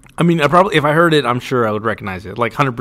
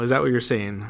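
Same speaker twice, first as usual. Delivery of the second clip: ordinary talk, nothing marked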